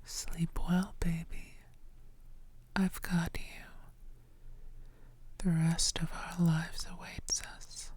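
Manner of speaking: ordinary delivery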